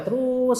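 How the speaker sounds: "terus" with a very long held U; drawn-out word. Shown as a demonstration. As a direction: stretch "terus" to show the sound flowing. Stretches word to show flow.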